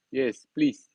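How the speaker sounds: normal talking voice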